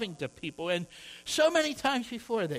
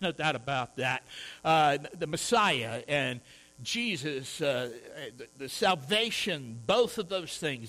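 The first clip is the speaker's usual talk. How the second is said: ordinary talk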